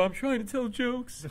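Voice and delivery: high, slow timid answer; small high-pitched reply; meek comic. Acted. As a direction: plead it meekly to a bully. Nervous comic answers the heckler.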